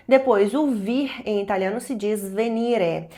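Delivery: usual speaking voice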